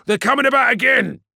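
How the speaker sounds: loud, high, aggressive snarl; snarled warning. A narrator's reading. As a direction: snarl the warning across the bridge. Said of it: "snarled Vimana Cybus".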